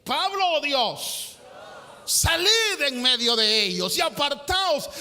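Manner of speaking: loud, high-pitched pulpit delivery; fiery preacher proclaiming; preaching at full force. Acted. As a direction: thunder the verse out to the congregation. Preaching the scripture aloud.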